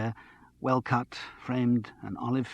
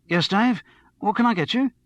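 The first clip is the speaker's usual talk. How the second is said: higher, louder matey barman's voice; eager barman; 10 semitones higher, 5 dB louder than usual. A narrator's reading. Barman greeting the radio star at his shoulder, eager and matey. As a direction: pipe up brightly to serve a regular.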